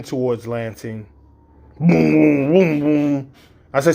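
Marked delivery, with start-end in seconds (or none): 1.8-3.3 loud imitated booms of speed; mock engine boom. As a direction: thump out each boom like a race car. Daughter speeding, car noise.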